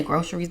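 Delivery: "groceries" said GRO-sher-eez. Shown as a demonstration. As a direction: slip a "sh" into "groceries". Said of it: "if you're feeling play a pimp".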